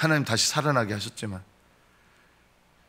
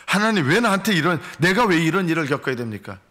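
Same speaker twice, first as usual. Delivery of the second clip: higher, louder wounded protest; complaining to God; 4 semitones higher, 3 dB louder than usual. Retold or imitated. Preacher acting out a believer hit by trouble, protesting. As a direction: cry out at God as if wronged. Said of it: "우리가 어떻게 반응합니까?".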